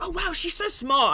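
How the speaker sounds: high-pitched gushing praise, put on; imitated admiration. Retelling co-workers praising her, mock admiring.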